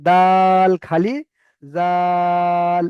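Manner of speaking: দাল and জাল stretched very slowly; drawn-out letter names. Shown as a demonstration. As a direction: hold each letter name long. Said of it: "তাহলে খেয়াল করতে হবে".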